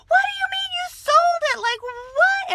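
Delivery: high, loud disbelieving cry; shocked shout. Retold or imitated. A stunned saleswoman yells at the new girl, shocked and loud. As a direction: yell it in total disbelief.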